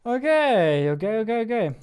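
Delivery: ordinary talk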